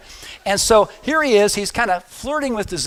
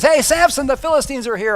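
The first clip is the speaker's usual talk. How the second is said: alarm call; 7 semitones higher than usual. Retold or imitated. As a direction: call out sharply to alert him. Delilah's alarm call again.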